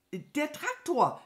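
high, drawn-out alarm call; alarmiertes Rufen. A narrator's reading. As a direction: cry out a high warning. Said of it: "rief die Krähe". (alarmiert und hoch rufen)

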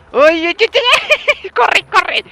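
high, loud panicked yelling with a laugh; real gamer panic; panicked cries. Real, not acted. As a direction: scream at the horde to run. Overrun by zombies.

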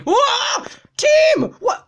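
very high, loud surprised yelp; high startled cry; startled shriek. Real, not acted. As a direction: yelp in shock. Startled by player in game.

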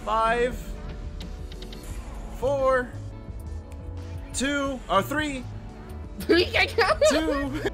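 rising, eager countdown; excited countdown. Real, not acted. Counting down to the shot.

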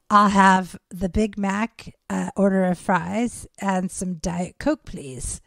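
slow, deliberate food order; sleep-talker ordering. Retold or imitated. Friend ordering a meal asleep, slow and deliberate.

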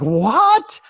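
high, loud astonished yelp; shocked what. Retold or imitated. Hearing he'd speak to Downton Abbey, shocked and loud. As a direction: blurt it out in disbelief. Imagined reaction to surprising news.

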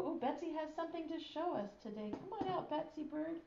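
soft, fast hushed coax. Acted. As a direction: coax the shy bird out gently. Coaxing a puppet out.

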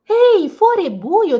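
high, loud little elf call; an eager elf calling everyone's attention; bright elf voice announcing the dark; lively elf Elvis. Acted.

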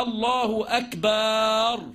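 الله أكبر with the ب of أكبر stretched long, said very slowly; lengthened ba. Shown as a demonstration. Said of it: "يعني ما يقولش إيه".

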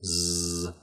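"Z" as a sustained buzzing zzz; Z sound. Shown as a demonstration. Teacher contrasting it with S, "Z" as a buzzed sound. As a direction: say "Z" as just its voiced buzz, held. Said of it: "Z sound".

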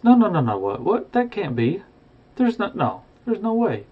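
high, rising cries of disbelief; a stunned old miser refusing the news. Acted. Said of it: "Scrooge is in disbelief".

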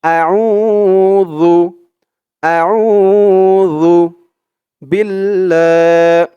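আউযু and Billah chanted slowly; drawn-out chant for repeating; slow recitation. Shown as a demonstration. Teacher leads students in slow chant. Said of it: "তো আমার সাথে বলুন".

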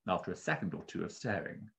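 usual speaking voice

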